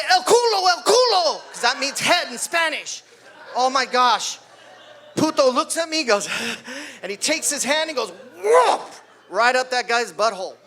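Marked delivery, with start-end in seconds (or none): 0.1-1.4 high, loud mat-side yell; shouting coach. Retold or imitated. Coach shouting wrong Spanish word. 8.4-8.8 high, loud whoop sound effect; a sudden comic whoop. Retold or imitated. Imitates the sudden move.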